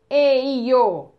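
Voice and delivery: "Eio" stretched loud, each vowel held; sounded-out vowels. Shown as a demonstration. Teacher sounds out vowels for pupils, loud and stretched. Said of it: "É sempre o som".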